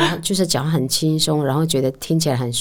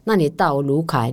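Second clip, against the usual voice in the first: ordinary talk, nothing marked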